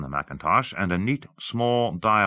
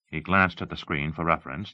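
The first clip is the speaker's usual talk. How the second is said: usual speaking voice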